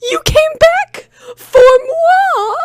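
high, slow swooning cry; gushing damsel. Acted. Anne gushing at her rescuer, high slow cry. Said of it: "cried Anne".

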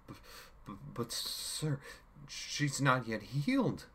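slow, high timid pleading; stuttering plea. A narrator's reading. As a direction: beg it with a stammer. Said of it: "the meek man pleaded".

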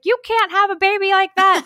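high, loud disapproving mom; mock a mother's outraged objection; scolding mom.

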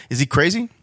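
usual speaking voice

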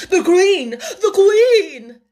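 high, loud alarmed cry; calling out. Acted. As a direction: cry out the warning at full voice. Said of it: "called out".

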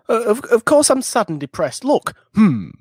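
high-pitched mock indignation; stammered, hammed-up protest ending in a hum; protesting retort. Imitating a fake depressed rock star proving he's sad, protesting. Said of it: "I just like the retort of".

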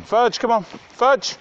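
loud, high call across the field; calling the dogs. Real, not acted. Owner calling her dogs back on a walk, loud high call. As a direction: shout them back to heel.

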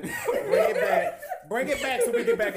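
very high, slow laughing call. Real, not acted. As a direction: drag it out through laughter. Laughing mock command, drawn out.

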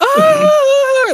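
high, loud sudden roar; class roaring. Retold or imitated. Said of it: "erupted in a roar".

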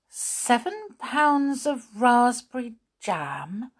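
high, slow, rising question; disbelieving echo. A narrator's reading. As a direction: repeat it as if you misheard. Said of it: "wondering if she had heard all, all right".

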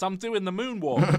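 high, loud cartoon dog voice; silly voiceover for a dog; goofy dubbed dog voice. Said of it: "one of the dogs ... and says".

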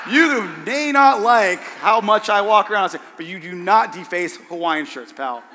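hammed-up outrage, starting loud; playful scolding, dropping on the last word; mock indignation. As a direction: comically lay down the law. Acted comic retort defending shirts.

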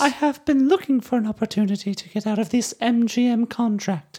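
high dainty actress voice; prim, sweet starlet voice; Grace Kelly voice. Retold or imitated. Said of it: "And she says".

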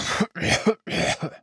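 short wounded cough; a rough pained cough. Acted. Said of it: "antwortete Gavin hustend".

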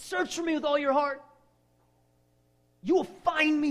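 high, loud ringing declaration; proclaiming Jesus. Acted. Preacher voicing Jesus' call, high and loud. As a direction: cry it out like Jesus calling.

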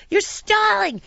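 high-pitched indignant accusation; high accusing yell. Retold or imitated. Traveller accusing the crew of stalling, high angry cry. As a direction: throw the accusation out high, in mock outrage. First accusation, acted outburst.

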